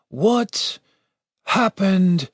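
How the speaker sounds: high, strained words broken by pauses; pained, halting. A narrator's reading. Injured guard asking the healer, in pain. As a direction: push each word out through pain. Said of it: "He winced in pain".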